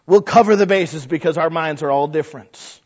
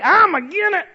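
much higher, louder contrary put-on voice; Brother I'm Agin It; 13 semitones higher, 4 dB louder than usual. Church naysayer rejecting every plan, high and loud. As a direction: object to everything in a high stubborn squawk. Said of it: "called Brother I'm Agin It".

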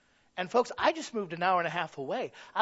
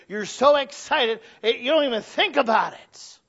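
louder, higher, building preacher's fervor; excited preaching; 6 semitones higher, 8 dB louder than usual. Real, not acted. Preacher rousing the congregation, excited and loud. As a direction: lift them up with the joy of serving.

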